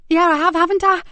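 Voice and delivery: put-on high, loud boyish yeah. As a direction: chirp it like a relieved kid. Boy pleased and reassured.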